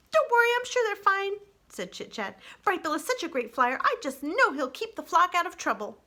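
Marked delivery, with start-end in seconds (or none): 0.1-1.3 high, bright-eyed little chatterbox; high, wistful young critter; chatty young opossum. Acted. Chit-Chat character voice. 2.6-6.0 high, bright-eyed little chatterbox; high, wistful young critter; chatty young opossum. Acted. Chit-Chat character voice.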